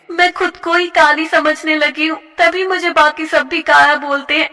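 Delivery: put-on determined young girl. A hurt girl vowing to herself, determined. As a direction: promise yourself you'll surprise them.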